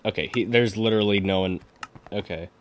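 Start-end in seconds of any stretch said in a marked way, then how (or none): none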